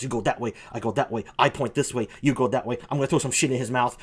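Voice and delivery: low-pitched ape tactician; apes plotting. Acted. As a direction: give out the plan low, like an ape squad leader. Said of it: "giving each other hand signals".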